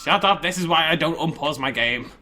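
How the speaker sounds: loud, exasperated snap; loud annoyed outburst. Real, not acted. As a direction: blurt it out, fed up. Annoyed at game noise.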